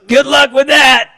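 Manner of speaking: high, loud, far-off mocking voice; teasing dad imitation. Dad teasing the boy about the five-story building, high loud mock. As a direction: toss it off as a high loud joking send-off. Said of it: "he goes".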